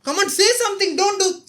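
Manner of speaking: high, loud desperate plea at the screen; loud pleading cry; pleading shout. Real, not acted. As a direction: yell at him to speak up.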